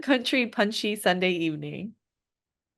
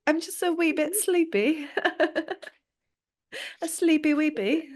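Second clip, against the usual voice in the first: high mock British accent through giggles; silly high British accent; 9 semitones higher than usual. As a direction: play a cutesy Brit with a British accent. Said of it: "I want it to be in a British accent".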